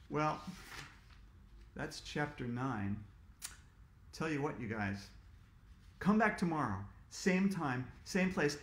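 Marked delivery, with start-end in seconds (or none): none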